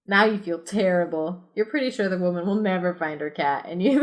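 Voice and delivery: ordinary delivery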